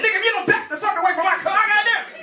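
high, fast, worked-up warning; mock angry warning. Driver warning man off his car, high and irate.

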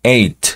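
"eight" with the GH silent. Shown as a demonstration. Teacher models "eight" without the GH. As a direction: skip the GH in "eight". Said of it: "como eight".

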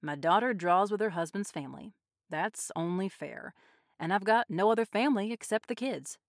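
usual speaking voice

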